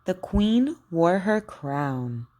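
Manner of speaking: slow, carefully shaped practice sentences; over enunciating. Shown as a demonstration. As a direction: model each Q word slowly and crisply. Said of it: "Just repeat after me".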